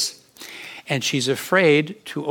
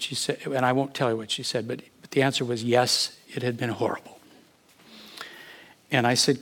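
normal talking voice

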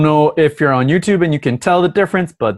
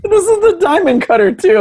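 high squeal; 6 dB louder than usual. Real, not acted.

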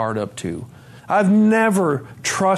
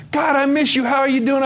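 light imitation of a delighted friend; excited greeting; 11 semitones higher than usual. Woman greets him warmly at McDonald's.

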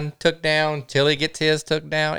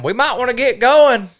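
high, mildly mocking imitation of a trip leader; Chad; 8 semitones higher, 4 dB louder than usual. Camper mimicking his friend's storm warning, high mock voice. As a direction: imitate a buddy's warning, pitched up.

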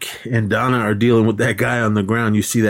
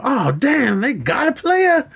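high, slow, drawn-out impressed voice; impressed Money Mike; slower than usual. Retold or imitated. Money Mike admiring the shoplifter, high and drawn out. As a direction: stretch it out, high and amazed. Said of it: "Money Mike go".

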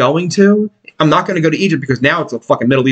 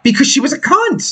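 emphatic; 11 semitones higher than usual. Real, not acted. Answering "why" about the bartender.

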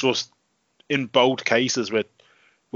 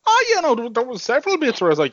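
much higher, eager answer; excited; 11 semitones higher, much faster than usual. Real, not acted. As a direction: burst in enthusiastically. Eager reply.

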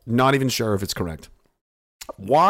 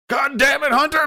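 high, loud mock yell of frustration; mock exasperated cry; 4 dB louder than usual. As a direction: yell at Hunter like a fed-up handler. Exasperated figure yelling at Hunter.

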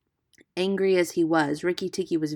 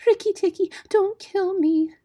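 almost weeping; 7 dB louder than usual. A narrator's reading. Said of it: "almost weeping".

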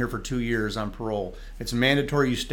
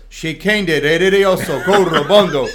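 loud tongues, echoing back with a laugh; Doctor Barkley answering in tongues, loud and joyful; 4 semitones higher, 10 dB louder than usual. Retold or imitated. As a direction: fire the tongues right back. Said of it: "without even a pause, he says".

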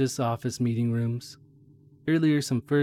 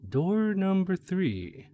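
dramatic slow reveal; 6 semitones higher, much slower than usual. Acted. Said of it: "dramatic door reveal".

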